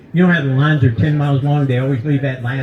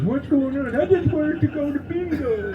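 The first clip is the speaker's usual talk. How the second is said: high, soft put-on voices for judge and old man in the wheelchair; judge and old defendant voices; 12 semitones higher, 7 dB quieter than usual. Comic telling the crowd what the judge said, in high soft mock voices. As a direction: squeak it out like a frail old man pleading in court. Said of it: "The judge goes".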